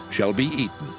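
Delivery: ordinary delivery, nothing marked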